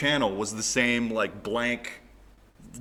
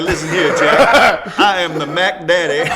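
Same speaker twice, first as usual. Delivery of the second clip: loud, higher, hammed-up pimp boast; mock pimp; 7 semitones higher, 13 dB louder than usual. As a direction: play a swaggering pimp, half laughing. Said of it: "you were pimping".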